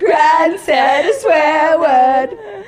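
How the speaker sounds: slow, loud, drawn-out sing-song; mock child tattling, laughing; childish tattling. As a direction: chant it like a kid telling on someone. Repeats tattle very slowly.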